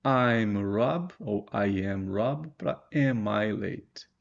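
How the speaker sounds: very slow, deliberate model phrases; slow contrast examples. Shown as a demonstration. Teacher contrasting statement and question, slowly. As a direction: set the two word orders side by side slowly. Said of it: "o que que a gente vê de diferente".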